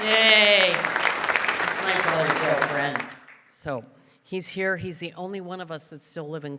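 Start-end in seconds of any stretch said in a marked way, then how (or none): 0.0-3.0 loud, high, stretched cheer. Real, not acted. Sister cheering her brother as he stands, loud and high. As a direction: whoop for your brother.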